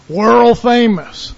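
loud, drawn-out boast; emphatic proclamation. Real, not acted. Preacher boasting of Jesus to his congregation, loud drawn-out proclamation. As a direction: stretch both words out and punch them. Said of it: "allow me to say it like that".

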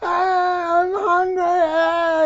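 high, sustained imitation baby wail; mock baby crying for milk; newborn's hungry cry. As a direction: wail like a hungry infant, drawn out. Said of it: "just like a newborn baby desires the milk by going".

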